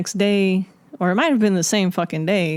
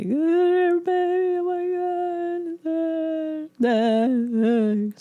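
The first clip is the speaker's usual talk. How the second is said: high, long drawn-out imitation wail; mock crying; 11 semitones higher, much slower than usual. Calling husband at work in a sob story, mock crying. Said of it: "you know, like, just crying".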